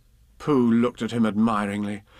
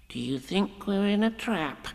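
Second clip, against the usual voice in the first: nervously; 9 semitones higher, 4 dB quieter than usual. Acted. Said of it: "he went on nervously".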